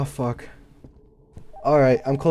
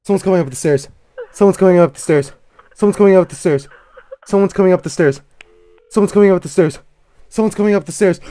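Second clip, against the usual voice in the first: high, loud frantic repetition; chanting it in terror; panicked repeating; 7 semitones higher, 9 dB louder than usual. Real, not acted. Terrified gamer hearing someone climb the stairs. Footsteps on stairs, horror game.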